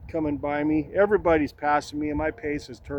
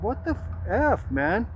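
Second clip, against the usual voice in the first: incredulous outburst; 6 semitones higher, slower than usual. Retold or imitated. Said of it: "and I'm like".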